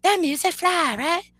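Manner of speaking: mock her gushing in a high, small voice; imitating hype person. Streamer mimics hype person praising his rap, high mocking voice. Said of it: "pretending that you don't know him, saying".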